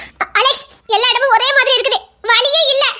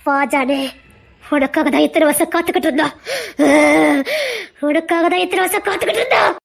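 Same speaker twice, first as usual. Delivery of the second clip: deep, low eerie beckoning; deep demonic beckoning; ghostly call; 10 semitones lower than usual. Acted. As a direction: lure him in like a spirit. Spirits call John to them.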